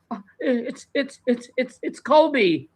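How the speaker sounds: high-pitched mock sobbing, halting repeats; retold sobbing stammer. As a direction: imitate a caller struggling to get the name out. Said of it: "she couldn't stop, like, sobbing".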